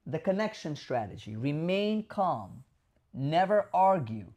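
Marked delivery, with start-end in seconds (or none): none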